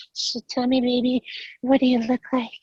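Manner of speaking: high, breathy-free put-on woman's voice; fake girl voice. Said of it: "talk to them as Jennifer".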